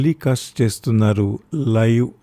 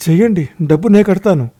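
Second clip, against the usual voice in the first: urgent; 7 semitones higher, 6 dB louder than usual. A narrator's reading. Father urging doctor to operate.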